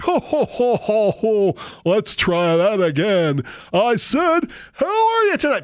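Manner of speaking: high, laughing showman, slow on the retry; fake-jolly MC asking the crowd again; hammed-up emcee. Preacher mimics an emcee making the crowd answer again, high and hammy. Emcee demanding more energy.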